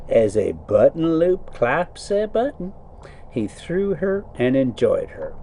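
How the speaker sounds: put-on reading the old tale aloud, a touch storyteller; story narration. A narrator's reading. Trucker reading to his viewers while driving, story narration. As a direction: read it like a bedtime book. Reads Arabian Nights aloud.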